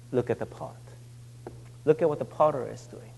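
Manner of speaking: soft and hushed, as God speaking; God's voice, hushed. Acted. Preacher voicing God to the congregation, softly hushed. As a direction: let God's words fall quietly. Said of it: "And then God says".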